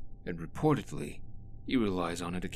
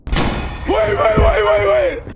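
much higher and louder, shouting wait; panicked yelling wait over and over; frantic shout; 13 semitones higher, 17 dB louder, slower than usual. Acted. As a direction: cry out to stop someone in a panic. Panicked character stops the other.

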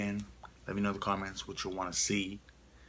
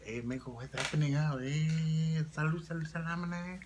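higher, Spanish-flavored put-on accent; 7 semitones higher, 3 dB louder than usual. Shown as a demonstration. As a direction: show off a lilting Spanish-style accent. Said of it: "here's my accent for y'all".

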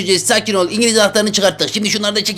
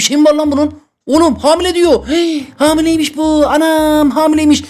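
shocked; 7 semitones higher than usual. Real, not acted. Shocked the watermelon is pregnant.

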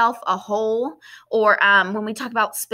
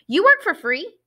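surprised question; 8 semitones higher than usual. Acted. Georgina disbelieving in the story.

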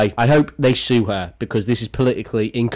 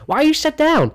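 higher, mocking imitation of the singer; 13 semitones higher than usual. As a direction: ham up her taunting the fan. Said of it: "She goes".

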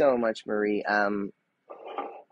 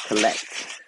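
ordinary talk

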